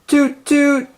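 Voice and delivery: high, loud train whistle toot; horn imitation. A narrator's reading. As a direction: sound the horn as the train arrives.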